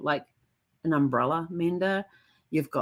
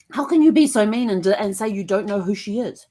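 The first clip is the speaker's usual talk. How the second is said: higher, louder, accusing the book; telling off a long-dead relative; irate scolding; 6 semitones higher, 4 dB louder than usual. Retold or imitated. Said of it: "I was getting irate".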